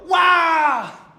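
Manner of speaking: loud, high shout of astonishment; astonished wow. Acted. Synagogue crowd stunned by the exorcism, shouting wow. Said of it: "Ahora se asombraron, dijeron".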